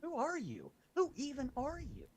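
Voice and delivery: high disbelieving questions; incredulous. Retold or imitated. Partygoers question a stranger, high and disbelieving. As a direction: demand to know who he is.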